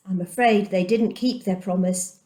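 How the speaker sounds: usual speaking voice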